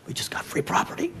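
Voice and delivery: whispered aside; retold stunned whisper; hushed amazement. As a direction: whisper it like a secret stroke of luck. Stunned aside after the offer.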